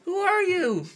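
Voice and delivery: very high, squeaky cartoon voice, laughing through it; shrill-pitched angry little cartoon guy guarding his tree; tree guy impression. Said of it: "that impression of the, the tree guy from that, from Accounting".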